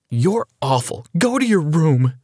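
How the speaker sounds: upset mother's rising outburst; upset cry. A narrator's reading. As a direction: send them away, voice rising on the order. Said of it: "she cried".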